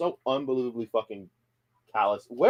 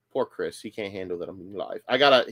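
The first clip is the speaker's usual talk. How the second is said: normal talking voice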